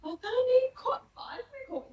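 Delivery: high, soft, mock tearful whimper; imitated child crying. Scolded child answering father about lost slippers, mock crying. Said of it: "रो रहे हैं".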